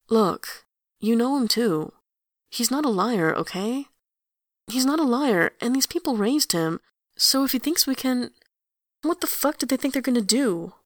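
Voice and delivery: usual speaking voice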